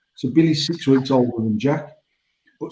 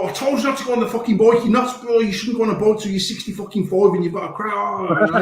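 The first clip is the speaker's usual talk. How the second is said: higher, fast scolding rant; angry growl; 7 semitones higher than usual. Retold or imitated. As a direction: tell the kid off in a panic. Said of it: "I end up absolutely growling at her".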